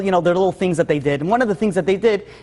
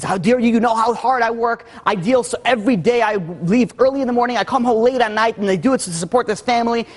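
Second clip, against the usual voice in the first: higher-pitched retold tirade, short of screaming; mock angry outburst; 6 semitones higher than usual. Husband blowing up at wife over his cufflinks, mildly angry. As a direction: imitate an indignant husband blowing up. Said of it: "he blew up at her and he started screaming at her".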